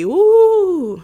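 loud, high mocking ghost ooh; a loud, high haunting ooh; ghostly ooh. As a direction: moan it like a ghost to mock the family. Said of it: "like y'all some ghosts".

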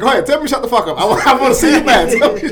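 high, loud laughing taunt; laughing dare. Retold or imitated. John laughing as he dares Kevin to snap, high and loud. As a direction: goad him, chuckling. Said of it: "He started laughing".

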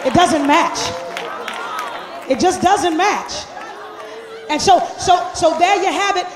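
loud, rousing sermon delivery; full-force preaching. Acted. Pastor rebuking hypocritical churchgoers, preaching loud and fired up. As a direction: preach it hard and call them out.

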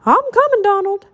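high shouted reply; urgent, high-pitched shout. A narrator's reading. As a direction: call back that help is on its way. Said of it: "Mickey shouted".

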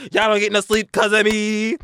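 loud, high, drawn-out holler; mock house-waking yell. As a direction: holler it to wake the whole house, half joking.

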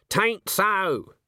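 high, cross heckle from the audience; indignant snap. A narrator's reading. Granny heckles a puppet show from among the children, snapping. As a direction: snap it out, scandalised. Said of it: "snapping things like".

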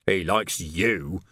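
a slow, sour, resentful line; bitterly. A narrator's reading. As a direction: spit it out with grudging bitterness. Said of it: "said Nock bitterly".

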